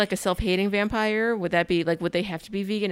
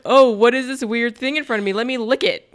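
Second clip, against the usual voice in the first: louder, higher dopey voice of a curious fool; clueless guy; 4 semitones higher, 7 dB louder than usual. Retold or imitated. Mocking a stranger, in a loud dopey voice. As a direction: play the idiot who wants to lick it.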